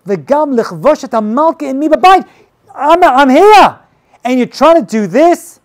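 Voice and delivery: high, loud, slowed outrage; a king catching Haman at Esther's bed, shouting; outraged king. Acted. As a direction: shout down the traitor in disbelief.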